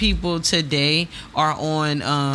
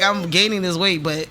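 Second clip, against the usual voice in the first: ordinary talk, nothing marked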